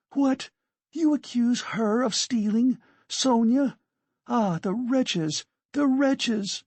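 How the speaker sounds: high-pitched indignant outburst; outraged cry. Acted. Katerina rushes at the accuser.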